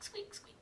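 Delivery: high, breathless hamster squeaks; tiny squeak. A narrator's reading. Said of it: "It probably sounded like squeak".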